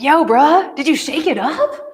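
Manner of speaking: put-on teasing bro-talk to the kid; casual kid slang. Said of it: "'cause he calls everybody bruh".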